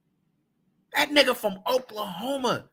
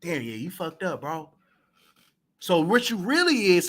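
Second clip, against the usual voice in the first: ordinary delivery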